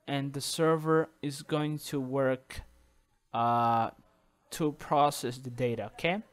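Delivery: ordinary talk, nothing marked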